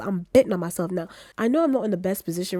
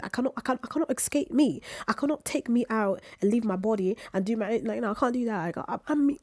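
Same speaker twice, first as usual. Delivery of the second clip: light mock British accent, pitched higher; half-hearted British voice; 4 semitones higher than usual. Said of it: "I gotta drop this British accent".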